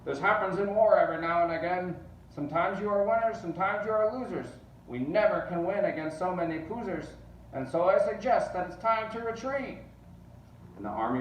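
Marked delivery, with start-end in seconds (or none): 0.0-9.8 much higher and louder, a blustering shout; shouting general. A narrator's reading. General ordering his men to retreat, loud shout. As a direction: bluster the retreat at full volume. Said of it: "shouted to his men".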